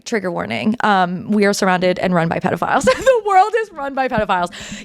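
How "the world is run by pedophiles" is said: said through a high rising laugh; real laughing despair. Real, not acted. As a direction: laugh in disbelief. Laughing disbelief.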